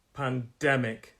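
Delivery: "Pandemic" slowed, leaning on DEM; stress on second syllable. Shown as a demonstration. Teacher showing where stress falls in "Pandemic", second syllable stressed. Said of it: "on the second syllable".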